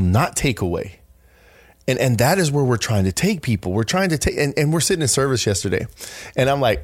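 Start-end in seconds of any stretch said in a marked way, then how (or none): none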